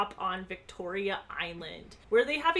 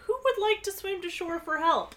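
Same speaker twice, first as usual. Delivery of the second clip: higher-pitched jokey call to everyone; joking offer; 9 semitones higher, faster than usual. A narrator's reading. Pilot to stranded passengers, joking offer. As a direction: toss it out as a joke. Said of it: "he was specifically joking".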